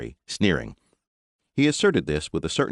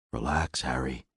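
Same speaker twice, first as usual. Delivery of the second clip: soft whispered reassurance; low voice; 4 semitones lower, 6 dB quieter than usual. A narrator's reading. Captain quietly calming his tense sergeant, whispering. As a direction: whisper to calm him down. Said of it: "he said in a low voice".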